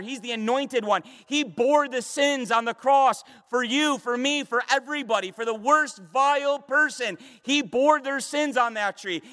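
fervent preacher in full flow; impassioned preaching. Real, not acted. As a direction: hammer each title home like a proclamation.

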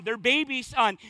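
ordinary talk

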